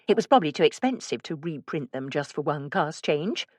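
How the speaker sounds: ordinary delivery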